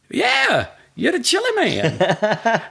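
high, drawn-out mimicked voice; stretched-out high impression of her; imitated woman. Quoting the woman's answer in mimicry.

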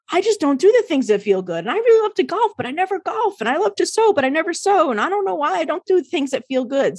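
high, whiny confessing voice; voicing the members. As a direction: play the people admitting it. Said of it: "so many people talked about how".

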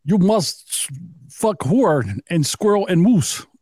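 slow cartoon Russian villain accent; hammed-up Russian accent, words spaced out; Natasha. Host borrowing a cartoon spy's voice, mock Russian accent. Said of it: "Natasha from the fucking Bullwinkle cartoons".

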